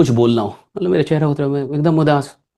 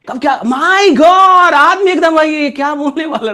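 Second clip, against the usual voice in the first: high and loud, drawn out slowly; unpredictable pitch; 8 semitones higher, 7 dB louder, slower than usual. Shown as a demonstration. Teacher showing students unpredictable pitch, high and slow. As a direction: stretch it out high to keep them guessing. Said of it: "तो pitch में भी ऐसी चीज होती है".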